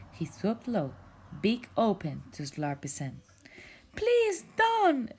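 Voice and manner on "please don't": high, loud panicked shout. Acted. Jellyfish shouts at pelican not to swallow brother, loud panicked. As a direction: stop him with a cry. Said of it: "Spencer shouted".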